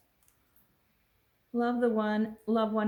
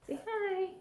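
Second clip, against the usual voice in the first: high pet voice; 11 semitones higher than usual. Real, not acted. Coaxing the cat to greet.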